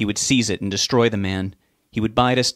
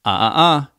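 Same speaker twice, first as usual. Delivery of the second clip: higher, louder warning tut; 5 semitones higher, 6 dB louder than usual. A narrator's reading. Father warning Rob while aiming the gun. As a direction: stop him with a sharp tut. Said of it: "his father warned".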